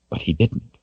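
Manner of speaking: ordinary delivery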